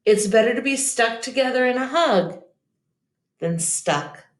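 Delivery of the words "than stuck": usual speaking voice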